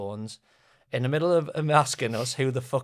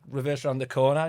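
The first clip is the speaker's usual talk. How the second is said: ordinary delivery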